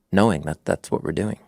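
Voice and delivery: ordinary talk, nothing marked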